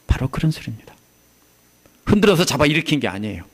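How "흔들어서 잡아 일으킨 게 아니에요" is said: ordinary talk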